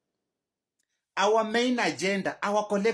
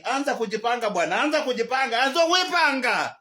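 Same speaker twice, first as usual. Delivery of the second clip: building angry rant; 5 semitones higher, 5 dB louder than usual. Real, not acted. Ranting at the president.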